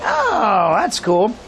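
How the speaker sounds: high drawn-out oh of understanding; mock realization. Visitor told what drunk meant, high mock realization. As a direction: pitch up as it finally clicks.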